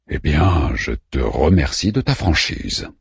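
low, measured companion voice; Orpheus's voice. A narrator's reading. Orpheus thanks Manmut for his frankness, low and composed. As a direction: drop the pitch, thank him soberly.

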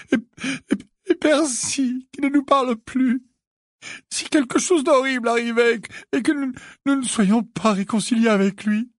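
high, loud, sobbing worry; tearful outpouring. A narrator's reading. Missus Wesley fears for her family, sobbing. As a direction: pour out the fears between sobs. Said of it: "des larmes débordant à nouveau".

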